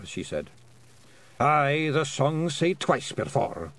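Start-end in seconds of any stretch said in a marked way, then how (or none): 1.4-3.7 higher Scots voice, slow and sure; a pict bard recalling songs; lightly voiced Scottish bard; voiced bard William.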